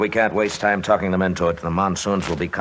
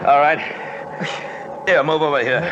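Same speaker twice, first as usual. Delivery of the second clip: leader shouts to his exhausted partner over the wind, raised voice; shouting over wind; 7 semitones higher than usual. Acted.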